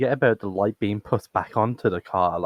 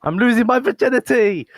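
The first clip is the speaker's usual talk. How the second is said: mock-triumphant announcement; 13 semitones higher, 6 dB louder than usual. Guest announcing a podcast debut as a joke, mock-triumphant. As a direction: belt it out high like a silly proclamation.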